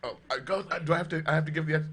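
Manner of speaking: ordinary talk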